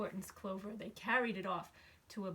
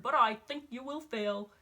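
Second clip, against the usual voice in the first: louder scornful jeer; sneering; 2 semitones higher, 8 dB louder than usual. Acted. Eagle mocking Horton before leaving, sneering. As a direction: gloat over his defeat. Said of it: "sneered the bird".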